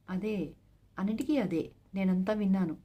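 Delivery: usual speaking voice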